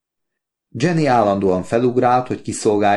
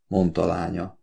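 plain reading voice

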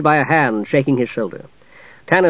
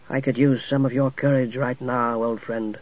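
low, soft and weary; quiet; 2 semitones lower, 9 dB quieter than usual. A narrator's reading. Tanis confides softly in his dead friend. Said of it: "Tanis said quietly".

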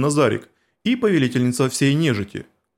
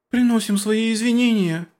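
put-on higher girls' apology; chastened apology; 7 semitones higher than usual.

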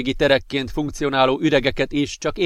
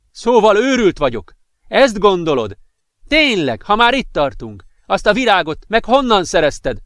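much higher, louder beside-himself rant; furious yelling; 6 semitones higher, 5 dB louder than usual. A narrator's reading. Jealous boyfriend screaming at girlfriend about flower, furious yelling. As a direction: lose control and bellow the accusations. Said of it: "üvöltötte magából kikelve".

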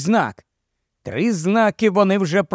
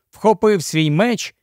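ordinary delivery, nothing marked

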